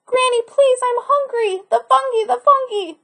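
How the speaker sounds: put-on hungry boy calling out; pleading cry. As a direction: beg Granny for food, hungry and eager. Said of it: "He ran into his house crying".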